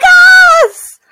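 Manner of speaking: very high, loud cheering laugh; screaming laugh and yes; triumphant shout. Real, not acted. Cheering the keyed car.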